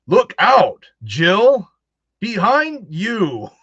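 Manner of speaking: loud, high, slow hammy warning; hammed-up game line. Imitating the old school voice mod calling to Jill, loud hammy warning. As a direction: ham it up like bad old game dubbing. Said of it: "And he's like".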